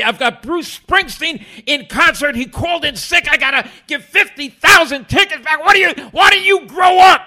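furious, high-pitched shouted impression; screaming. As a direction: scream at him in exasperation. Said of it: "he starts screaming at me".

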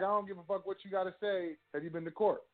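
defiant. Pretend. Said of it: "you should just say".

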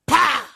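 sudden high, loud "pa!"; imitated slap. Acting out a passenger slapping the preacher, sudden smack sound. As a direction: punch out a slap sound effect.

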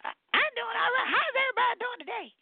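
high-pitched, chatty church-lady voice; a high sister fussing cheerfully at the room; Sister Peppercorn. Acted. Greeting the callers as Sister Peppercorn, high and chatty.

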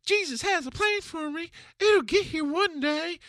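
very high, put-on wishful voice; mock naive believer. Speaker mimics passive faith in a plan, mock naive.

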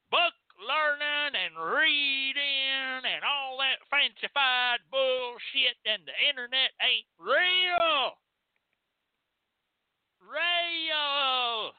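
high, slow, drawn-out country holler; mock country yokel. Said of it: "the thing they're saying".

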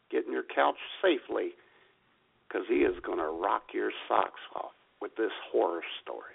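ordinary talk, nothing marked

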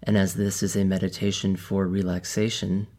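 calm, measured meditation guide with long pauses; softened, even delivery, leaving space between instructions; guided meditation. Real, not acted. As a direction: ease listeners into stillness, phrase by phrase.

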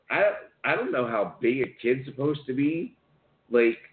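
ordinary talk, nothing marked

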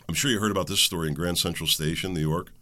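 usual speaking voice

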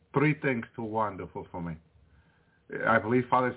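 ordinary delivery

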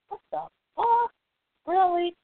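high, incredulous, rising; can't believe what he's hearing, high and sharp; stunned disbelief. Real, not acted. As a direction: pitch way up in disbelief. Disbelief at the Issa Rae backlash.

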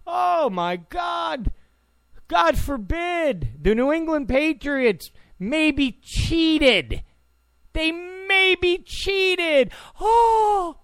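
mock the fans' horror with a slow, high wail; sarcastic mock outrage. Mocking Patriots fans crying foul over footballs, hammed-up high outrage.

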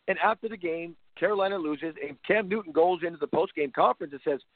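usual speaking voice

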